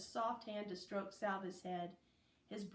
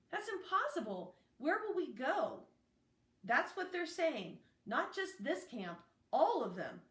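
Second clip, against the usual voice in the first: alarmed dialogue; 6 semitones higher, 4 dB louder than usual. A narrator's reading. Alarmed dialogue about camp closing.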